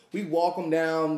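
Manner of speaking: ordinary talk, nothing marked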